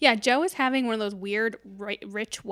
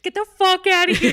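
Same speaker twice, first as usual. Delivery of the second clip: high, loud mock yell; hammed-up angry jeer; heckling crowd; 9 semitones higher, 10 dB louder than usual. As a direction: drive her out of the store. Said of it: "everyone goes, like, straight face again".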